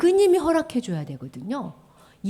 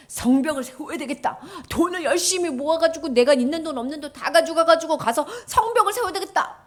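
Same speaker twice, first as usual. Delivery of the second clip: Nehemiah's resolve; 6 semitones higher than usual. Acted. Teacher voices Nehemiah's resolve.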